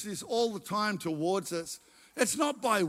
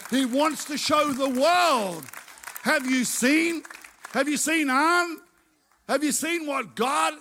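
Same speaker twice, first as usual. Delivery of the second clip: louder and higher, slowed and building; rising preaching shout; 5 semitones higher, 4 dB louder than usual. Real, not acted. Preacher building to a shout before the congregation. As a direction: climb to a triumphant cry.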